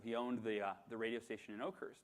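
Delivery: ordinary talk, nothing marked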